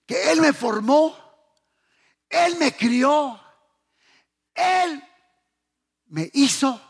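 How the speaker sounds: usual speaking voice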